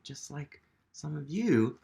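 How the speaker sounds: plain reading voice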